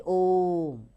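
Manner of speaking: slow, held ओम as a teaching example; drawn-out om. Shown as a demonstration. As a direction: say ओम slowly, holding the long o and the hum of m. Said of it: "जैसे".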